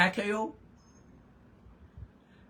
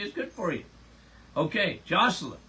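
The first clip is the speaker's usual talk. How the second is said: ordinary talk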